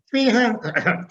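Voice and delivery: high, loud mock squeak; nervous choke. Nervous producer naming his inflated rate, comic nervous choke.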